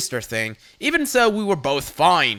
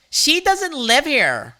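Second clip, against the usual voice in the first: smug; 9 semitones higher, slower than usual. A narrator's reading. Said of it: "looking very smug".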